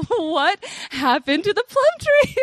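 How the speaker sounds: high, loud, slow cry of dismay; shocked dismay. Retold or imitated. At her butchered plum tree, a high loud shocked cry. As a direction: wail it out as if you can't believe it. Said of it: "that I was just like".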